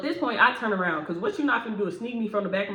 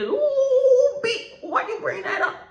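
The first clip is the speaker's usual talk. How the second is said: much higher, louder offended shriek; shrieking coworker; 6 dB louder, slower than usual. Retold or imitated. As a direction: squeal it out wounded and furious. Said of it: "She said".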